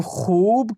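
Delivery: खूब with a long, stretched oo; drawn-out vowel. Shown as a demonstration. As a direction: hold the oo in खूब before closing on the b. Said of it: "वाव को खींचकर पढ़ा जा रहा है". (खूब को खींचकर, लंबे ऊ के साथ)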